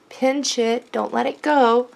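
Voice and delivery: normal talking voice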